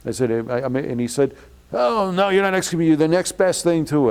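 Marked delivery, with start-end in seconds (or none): 1.7-4.1 high, loud scornful ranting; ranting rabbi. Retold or imitated. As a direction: spit the verdict out in a rage. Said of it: "he was ranting and raving".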